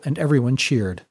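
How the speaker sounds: usual speaking voice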